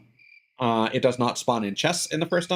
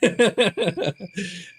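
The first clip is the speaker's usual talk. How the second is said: embarrassed laugh; 8 semitones higher than usual. Real, not acted. Laughing off an odd remark.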